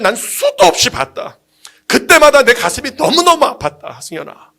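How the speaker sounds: slow, impassioned voice of a grieving God; preacher voicing God, drawn out and emphatic; God addressing preacher. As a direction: stretch the words out as God recounting long heartbreak. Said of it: "하나님 뭐라 그러시냐면".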